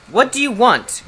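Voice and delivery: high, loud gruff demand; grunting beast. A narrator's reading. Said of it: "grunted the beast".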